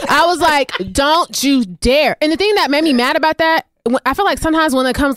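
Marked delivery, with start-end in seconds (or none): 0.9-2.2 slow, drawn-out retold warning; indignant warning. Storyteller replays warning Scott off his accusation, indignant. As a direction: space out each word as a mock warning.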